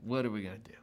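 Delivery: low, soft, mildly defeated; a touch of quiet worry; negative vibe. Retold or imitated. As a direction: mutter it as retold discouragement. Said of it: "this negative vibe going on".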